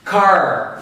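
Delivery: "Car" with the final R drawn out and curled; American pulled R. Shown as a demonstration. Teacher showing the American R, pulled R in "Car". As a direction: say "Car" American-style, R fully sounded. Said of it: "Ele puxa o R".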